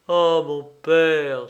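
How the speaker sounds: loud, drawn-out lament; pained cry. A narrator's reading. Prince wounded by his father siding with Pierrot, pained cry. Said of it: "s'écria douloureusement Horribilis".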